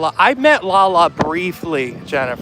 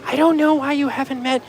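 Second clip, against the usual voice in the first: imitating Jennifer; 8 semitones higher than usual. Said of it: "Jennifer keeps saying".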